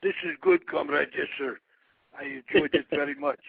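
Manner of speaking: put-on loud, booming Russian accent; big Russian wrestler voice, played for fun. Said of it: "talk with the Russian accent".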